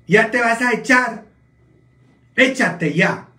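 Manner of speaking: slangy Oaxaca voice. Retold or imitated. Oaxaca family sending him to bed, loud mock voice. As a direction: drag it out like a rowdy relative. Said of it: "Sino decían".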